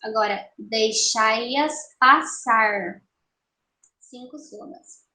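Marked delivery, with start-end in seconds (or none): 0.6-3.0 "deixai-as passar" slow, in separated syllables; escansão silábica. Shown as a demonstration. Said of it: "vamos fazer a escansão". ("deixai-as passar" devagar, separando as sílabas)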